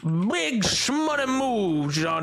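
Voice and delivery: slow swaggering drawl, hitting shmoney; hype catchphrase. Pretend. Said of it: "Look at that".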